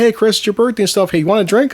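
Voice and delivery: high-pitched, eager party greeting; outgoing friendly guy. Retold or imitated. Matt offering Chris a birthday drink, high and friendly. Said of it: "Very friendly guy, very outspoken".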